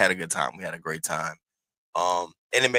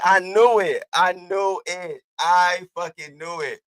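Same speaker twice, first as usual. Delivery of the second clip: high, loud retold wail, slowing as it repeats; exasperated outburst; 9 semitones higher, 6 dB louder, slower than usual. Replying to best friend about the eye, exasperated wail. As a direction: hammer it out in mock despair, high and drawn out.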